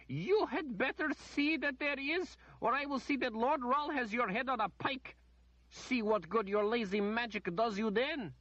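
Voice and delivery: high, loud, haughty threat; the lady threatening the wizard with a pike. A narrator's reading. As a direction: threaten him with a lord's power.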